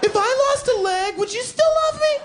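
very high, loud falsetto pleading; squeaky clingy falsetto begging; mock needy partner. Clingy partner begging as he leaves home, high falsetto.

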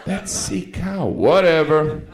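loud, high mocking heckle; heckler from the audience. Rude audience member jeering at Ricky, loud and mocking. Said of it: "mean people in the audience".

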